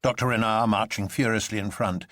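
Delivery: plain reading voice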